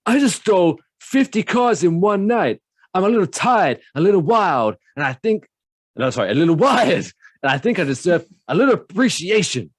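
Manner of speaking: high, loud hammed-up Nicolas Cage voice; over-the-top movie star rant; Nick Cage impression. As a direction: play it big and manic like Cage. Reads Nick Cage quote in voice.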